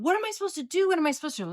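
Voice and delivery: high, frantic repeated plea; high, panicked rookie voice; panicking. Retold or imitated. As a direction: beg the partners for an answer. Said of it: "trying to talk to my partners going".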